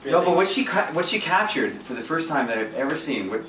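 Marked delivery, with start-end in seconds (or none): none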